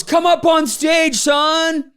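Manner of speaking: high, slow call to come up; a co-founder hailing him onto the stage, high and slow. Retold or imitated. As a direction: summon him up to the stage, pitched high and drawn out.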